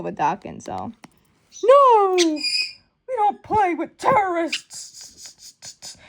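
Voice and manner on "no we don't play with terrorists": high, loud "No!" then a very slow put-on voice; hammed-up indignant hero; shouted refusal. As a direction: bark the no, then drag out the rest in character. Acting out a refusal.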